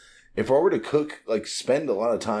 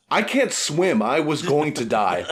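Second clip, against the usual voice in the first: higher, cheery panic with a laugh; quoting Luffy; 6 semitones higher, 4 dB louder, slower than usual. Retold or imitated. Luffy after nearly drowning, higher laughing panic. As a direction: toss it off like a carefree kid. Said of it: "'cause Luffy's like".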